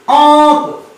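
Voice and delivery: अंक with a nasal vowel and no n; chandrabindu ank. Shown as a demonstration. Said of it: "तो इसका उच्चारण बदल गया".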